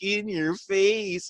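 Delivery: high, long drawn-out gloat; imitated sing-song gloating; mocking taunt. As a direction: stretch it out like a playground jeer. Imitating wrestlers taunting a departed guy.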